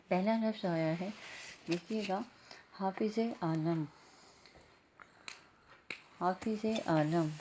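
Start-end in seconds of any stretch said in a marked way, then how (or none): 2.8-7.3 حافظ عالم read slowly, each sound clear, stress on حافظ; careful model reading. Shown as a demonstration. Teacher modelling حافظ عالم for students, slow and clear. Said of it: "ہے الف ہا،".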